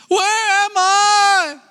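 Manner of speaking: very high, slow, drawn-out little voice; tiny lost voice. Acted. Baby bird lost and frightened, very high slow small voice. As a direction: squeak it out like a lost little chick.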